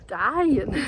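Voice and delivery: exhausted gasp tipping into a laugh; out of breath. Real, not acted. Climber nearing the top of the dune, out of breath. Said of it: "I'm huffing".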